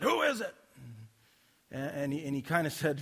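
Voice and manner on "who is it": high-pitched angry call through a door. Retold or imitated. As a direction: imitate an irritated man demanding who's there. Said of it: "heard this angry voice said".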